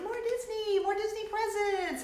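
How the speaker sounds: mock the pleading fans in a high voice; clamoring fans. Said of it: "you're always like".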